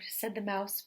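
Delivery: plain reading voice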